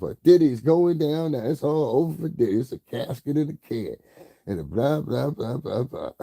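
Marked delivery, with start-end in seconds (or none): 0.2-4.0 higher mock gloating announcement; mocking gossip host. Mocking a YouTuber's dramatic intro, higher and gloating. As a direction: lightly parody her dramatic intro. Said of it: "that's how she always starts off".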